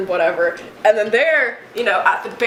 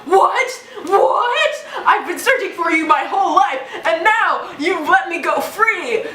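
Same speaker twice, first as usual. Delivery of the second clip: mock outrage; 7 semitones higher than usual. Kid retelling Javert, high disbelieving shout. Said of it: "He's like".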